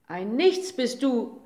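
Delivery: slow, loud, scornful shout; screaming uncle. A narrator's reading. Uncle mocks the little day, a slow scornful shout. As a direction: hurl it at him with contempt. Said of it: "schrie der Onkel".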